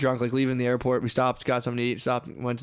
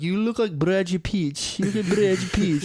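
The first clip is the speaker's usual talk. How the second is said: mock Brazilian accent; 6 semitones higher than usual. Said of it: "So they're like".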